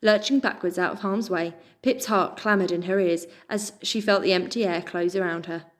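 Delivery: usual speaking voice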